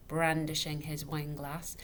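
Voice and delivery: ordinary delivery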